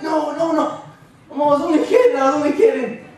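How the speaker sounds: very high, loud, laughing plea; panicked backpedal. Retold or imitated. Kid held overhead begging the wrestler, high, panicked and laughing. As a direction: squeal it out, desperate to take it back.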